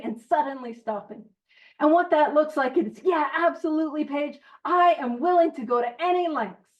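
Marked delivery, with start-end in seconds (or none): none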